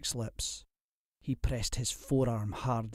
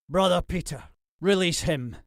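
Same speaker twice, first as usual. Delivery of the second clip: commanding lord; 6 semitones higher than usual. A narrator's reading.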